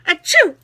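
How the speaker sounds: animated, twangy verse reading; twangy poem recital. Pretend. Poet recalls granddaddy's farm, twangy recital. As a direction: recite it heartily with a country twang. Staged sneeze interrupts poem.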